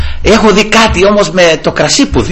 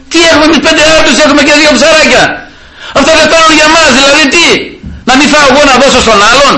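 protesting disciples; 11 semitones higher, much faster than usual. Retold or imitated.

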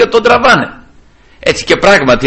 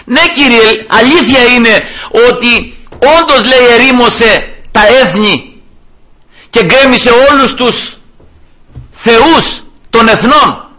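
high, slow, emphatic prayer; pleading prayer; 7 semitones higher, slower than usual. Acted. Hezekiah admits the nations' ruin to God, pleading. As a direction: draw out each admission before God.

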